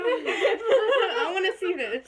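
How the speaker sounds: slow, loud, laughing hard. Real, not acted. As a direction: beg to see it while cracking up. Laughing eagerly.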